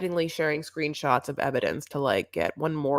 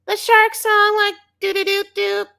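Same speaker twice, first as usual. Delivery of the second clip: very high, loud put-on chirp; Libby; 8 dB louder than usual. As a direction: mimic a children's song in a squeaky voice. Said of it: "she says it's".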